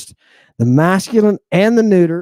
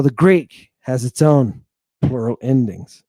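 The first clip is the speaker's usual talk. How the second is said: normal talking voice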